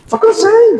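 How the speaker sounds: high, exasperated cry of being tired; just tired. Retold or imitated. Pastor quoting himself to his wife, high and exasperated. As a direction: throw it up high like you're fed up. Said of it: "Falei pra ela".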